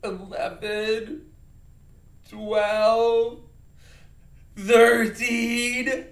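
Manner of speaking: loud, drawn-out hammed-up sobbing count; mock sad. Shown as a demonstration. Teacher counting for children, play-acting sad. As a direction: wail each number as if bawling. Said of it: "we're gonna be sad".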